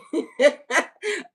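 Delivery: high, loud giggling peal; real laughing burst. Real, not acted. Host pleased with her quip, laughing out loud.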